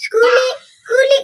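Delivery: high, drawn bird cry; kuliki bird call. Shown as a demonstration. Host models the kuliki call for boss, crying high. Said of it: "Balik suara kuliki".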